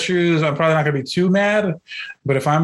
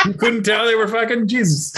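ordinary talk

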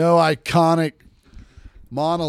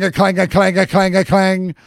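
repeated clang sound; 3 semitones higher, 5 dB louder than usual. Retold or imitated.

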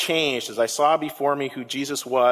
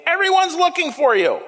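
much higher and louder, a real exclamation; excited exclamation; 13 semitones higher, 5 dB louder than usual. Acted. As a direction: call it out in a high, urgent voice. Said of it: "they exclaimed".

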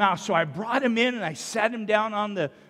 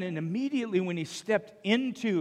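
usual speaking voice

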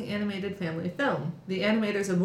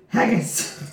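a jokey Scottish accent, a little louder; the one-word cartoon Scottish shortcut; mock Scottish accent; 5 dB louder than usual. Shown as a demonstration. Showing friends how to fake a Scottish accent, mock Scottish. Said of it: "for Scottish, you do".

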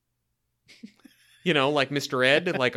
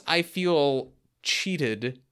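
very slow emphatic; much slower than usual. Real, not acted. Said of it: "emphatic drawn-out answer".

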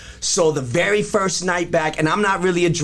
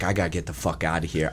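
low, quiet and rushed, barely getting it out; a spinning-head murmur, much softer and lower; dazed mumble; 11 semitones lower, 7 dB quieter, much faster than usual. Retold or imitated. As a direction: mumble it fast like you're about to pass out. High at concert, wants out.

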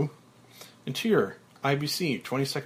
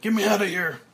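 high, loud frantic yell; berserk yell; 8 semitones higher, 6 dB louder, faster than usual. Acted. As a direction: scream to be let out. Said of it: "Frank goes berserk".

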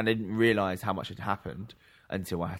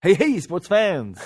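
loud, slow, high announcer call; sports announcer; 8 semitones higher, 9 dB louder, much slower than usual. Acted. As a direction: greet the fans like a stadium host. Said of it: "You can keep that one".

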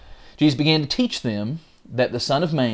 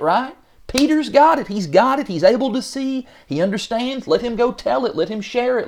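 much higher pitched mock protest to Jesus; a hammed-up disciple begging to go spread it; exasperated pleading; 6 semitones higher than usual. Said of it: "this is maybe where we would probably be like".

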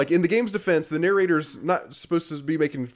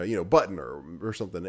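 ordinary delivery

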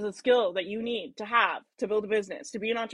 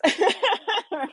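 a short, high burst of laughter; bright amused laugh; 11 semitones higher, 5 dB louder than usual. Real, not acted. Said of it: "I'm laughing".

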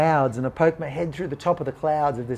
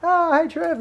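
high-pitched friendly hello back; cheery greeting; slower than usual. Retold or imitated. Friend greeting him back, retold.

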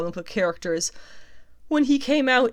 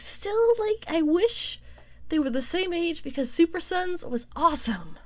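much higher, wishful whine; high wistful voice; 9 semitones higher than usual. Real, not acted.

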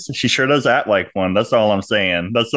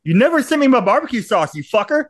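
high, joking accusation; mock outrage; 10 semitones higher than usual. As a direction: pretend to be offended. Mock complaint to Brock.

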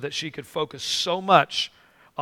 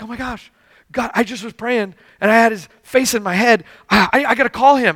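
higher-pitched urgent amazement, building louder; retold excited realization; 6 semitones higher, 5 dB louder than usual. Telling a friend about the man seen in prayer, excited. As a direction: build from astonishment to eager urgency.

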